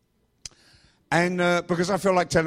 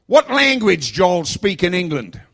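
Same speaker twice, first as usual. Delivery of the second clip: higher, louder Alabama drawl; Alabama accent; 4 semitones higher, 7 dB louder, slower than usual. Retold or imitated. Driver quizzing the hitchhikers, in a mock Alabama accent. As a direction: put on a rough-and-ready Alabama accent. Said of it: "This accent is a Vaughnabama accent".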